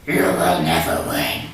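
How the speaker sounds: low, rasping devil's whisper; devilish whisper. Acted. The devil taunting the rangers, low devilish whisper. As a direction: breathe it like a demon threatening from the dark. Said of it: "the devilish voice said this".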